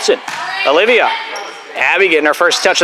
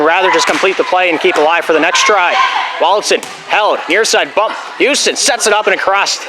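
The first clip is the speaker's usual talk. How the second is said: ordinary delivery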